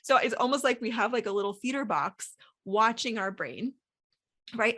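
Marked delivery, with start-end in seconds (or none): none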